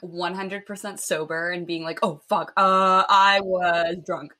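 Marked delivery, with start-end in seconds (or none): 2.0-4.3 slow, sheepish guy making an excuse; mock lame excuse. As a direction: drag it out like a caught liar. Mocking the guy's lame excuse.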